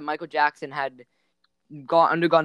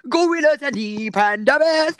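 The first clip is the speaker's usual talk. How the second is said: high, slow nature narrator; hammed-up wildlife narrator voice; documentary narrator voice; 10 semitones higher, 4 dB louder, much slower than usual.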